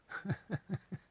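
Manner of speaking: high burst of disbelieving laughter; short high incredulous laugh. Real, not acted. Laugh at absurd claim.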